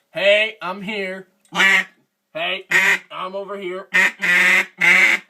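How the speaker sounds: high, loud, slow duck calling out; be a duck shouting for the flock. Pretend. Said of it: "Give a little".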